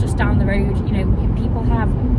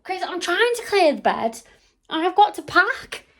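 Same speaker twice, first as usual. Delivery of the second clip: higher, raised voice across the room; calling out; 10 semitones higher, 4 dB quieter than usual. Real, not acted. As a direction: call out to Chris.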